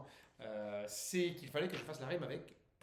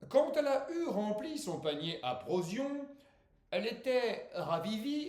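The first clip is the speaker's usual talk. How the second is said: slow, raised declamation; poetry recitation; 8 semitones higher, 7 dB louder than usual. Acted. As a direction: declaim the verse grandly, stretching the line endings. Declaims his poem. (poème déclamé avec emphase)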